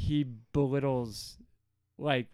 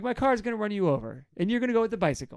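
high, incredulous rant, pushed louder; mock indignation; 7 semitones higher, 3 dB louder, faster than usual. God mocking Israel's poor choice, high indignant rant. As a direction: scoff through it in a raised voice. God's acted slam, car against bicycle.

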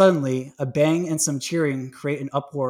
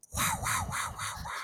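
sad trombone imitation; 11 dB quieter than usual. Shown as a demonstration. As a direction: mouth trombone wah wah wah. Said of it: "There, there's a sound clip for you".

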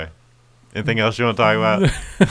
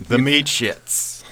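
announcer voice; 4 semitones higher, much slower than usual. Pretend. Echoing band name grandly.

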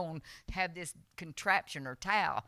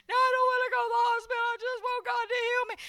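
high, fast wailing protest; crying; 6 dB louder, faster than usual. Retold or imitated. Begging not to go to the hospital, crying. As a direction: wail it out like a crybaby. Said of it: "I was crying".

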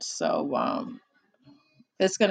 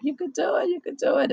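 inner voice; 7 semitones higher, faster than usual. Retold or imitated. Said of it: "my voice is".

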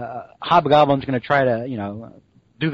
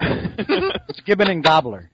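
mock announcement; 4 semitones higher, slower than usual. Real, not acted. Jokey pairing of names.

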